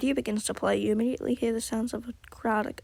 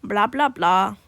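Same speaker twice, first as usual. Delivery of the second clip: mocking dismissal; 9 dB louder, slower than usual. Dismissing the other's lecture.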